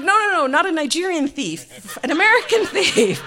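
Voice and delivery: high, loud mock protest; exasperated correcting. Wife sets husband straight, loud and exasperated. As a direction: pitch up high and insist.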